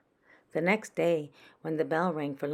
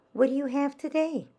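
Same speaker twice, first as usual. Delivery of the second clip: put-on high, louder child's question; curious little girl asking; 9 semitones higher, 5 dB louder than usual. Frances voiced as child.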